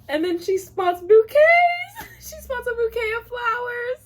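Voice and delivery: climbing into a very high squeal; overjoyed squeal. Real, not acted. Reader spotting flowers in the love story, squealing. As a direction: lose it completely, voice shooting up tearfully.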